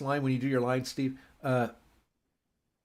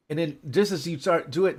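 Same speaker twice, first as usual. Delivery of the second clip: normal talking voice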